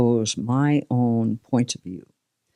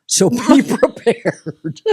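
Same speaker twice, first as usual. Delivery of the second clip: higher, louder, slow laughing warning; mock warning; 9 semitones higher, 5 dB louder, slower than usual. Guest jokingly warning about slow talkers. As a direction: warn them slowly through laughter. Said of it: "comic warning".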